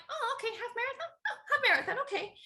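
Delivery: high, soft, quick musing; mock breezy acceptance. Runner retelling her race sign-up, blithely naive.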